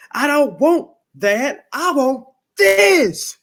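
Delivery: loud, high, drawn-out insistence; mock exasperation. Retold customer rejecting creamer, loud, slow and exasperated.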